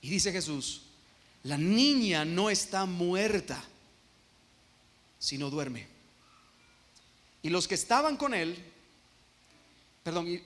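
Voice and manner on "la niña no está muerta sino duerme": slow Jesus quote. Acted. Jesus speaks of the girl.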